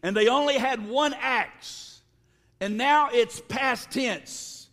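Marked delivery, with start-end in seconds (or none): none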